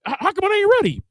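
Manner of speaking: high, mock-indignant demand; demanding husband. Husband demands to know why the feast isn't ready, mock-indignant. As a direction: squeak it out like a put-out husband. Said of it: "I'm inviting the brothers over".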